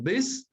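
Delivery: "visit" con la fuerza en "vis"; acento adelante. Shown as a demonstration. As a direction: separa "visit" y carga la primera sílaba. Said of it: "la mayor fuerza de voz está adelante". ("visit" acentuado al inicio: VIS-it)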